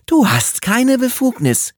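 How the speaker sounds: high, loud furious line; angry outburst. Acted. Woman furious at rival colleague, angry high outburst. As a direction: slam it at him in anger. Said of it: "knallte die Mappe wütend".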